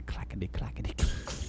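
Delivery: whispered tap clatter; whispered clackety. Retold or imitated. Imitating tap shoes on a running pig, whispered. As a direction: breathe the clackety clackety like tiny taps.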